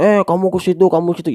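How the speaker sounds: bossy ordering. Pretend. Speaker mimics how not to urge an audience, bossy. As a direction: imitate someone bossing people around. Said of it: "Jangan anjurin".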